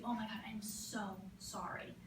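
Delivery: whispered, soft, mortified apology; the mom whispering an apology to the stranger; embarrassed whisper. Retold or imitated. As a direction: whisper it like an ashamed mother. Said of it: "and looks at you".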